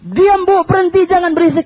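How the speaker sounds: high, loud shushing order; retold sharp telling-off; scolding disciples. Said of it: "perempuan tuh di waktu dibilang".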